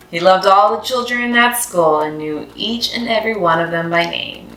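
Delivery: usual speaking voice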